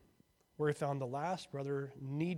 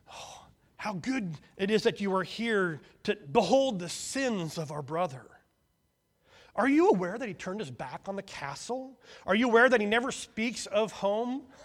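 loud, higher gloating tattle; shouted accusation; 6 semitones higher, 10 dB louder than usual. A narrator's reading. Fault-finding son shouting his brother's sins to firstborn, loud. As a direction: denounce the brother gleefully. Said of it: "he shouted".

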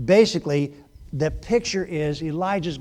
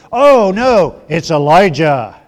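mock booming voice; 12 dB louder, slower than usual. His voice had reverb in it.

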